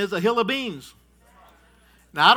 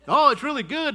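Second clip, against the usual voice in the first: bright, higher sing-song sweet-talk; coaxing parent; 6 semitones higher, 4 dB louder than usual. Retold or imitated. Parent sweet-talking a kid about the shot, high and coaxing. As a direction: sell the shot to a nervous child.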